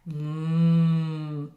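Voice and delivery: a hint of a lowing moo; low it like a cow. A narrator's reading. Cow greeting the truck, a light moo. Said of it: "Cow said".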